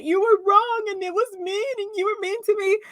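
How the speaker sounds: mocking a hurt woman complaining she was wronged; mock hurt victim. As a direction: play the wounded victim. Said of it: "She's so hurt".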